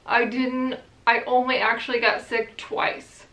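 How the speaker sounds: ordinary delivery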